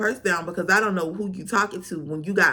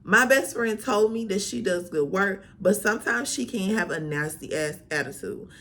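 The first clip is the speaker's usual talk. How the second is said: normal talking voice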